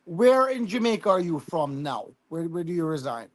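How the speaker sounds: usual speaking voice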